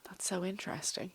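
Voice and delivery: hushed, intrigued murmur; soft whisper, almost ASMR; whispered reaction. Real, not acted. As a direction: breathe it out in a whisper.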